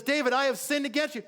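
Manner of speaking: very high, acted tearful admission; Saul, mock sobbing. Saul admits his sin to David, mock sobbing. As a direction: confess in a hammed-up crying pitch. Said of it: "It says he starts sobbing".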